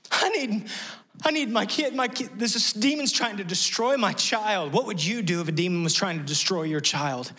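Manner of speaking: high, frantic pleading; desperate father. Retold or imitated. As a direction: plead high and fast, panicked for your kid.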